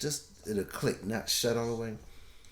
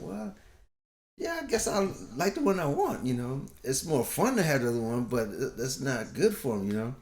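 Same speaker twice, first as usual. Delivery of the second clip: higher, half-joking confession; sheepish admission; 5 semitones higher than usual. Retold or imitated. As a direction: own up with a grin. His sheepish reply to counselor.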